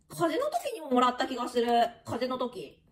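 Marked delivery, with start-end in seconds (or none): none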